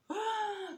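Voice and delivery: high sharp gasp; a sudden high intake of breath; shocked gasp. Retold or imitated. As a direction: gasp in astonishment. Shocked by the paid tickets.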